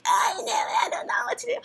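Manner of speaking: high, breathy crying whisper of panic; panicked crying friend. Retold or imitated. Friend at the fence cries she doesn't know what to do, high panicked whisper. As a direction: imitate a drunk friend sobbing in fear. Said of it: "and Nicole's like".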